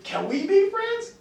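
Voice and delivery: high, loud, slow hopeful ask; mock-childlike asking. Adult trying the kid approach on a stranger, high hopeful. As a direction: ask like a kid at a grown-up party. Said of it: "I said".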